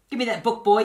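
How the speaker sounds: angry sorcerer yelling for his book. Acted. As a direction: bellow at the boy. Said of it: "yelled the sorcerer".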